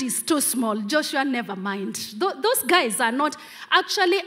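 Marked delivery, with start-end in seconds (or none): none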